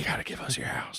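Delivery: unvoiced, sinister whisper; mock whisper. Host voicing government in a whisper. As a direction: hiss it as a sly threat.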